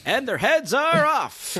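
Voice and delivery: very high, drawn-out theatrical exclamation; mock announcer cry. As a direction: belt it out slowly and high.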